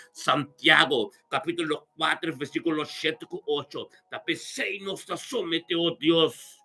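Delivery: normal talking voice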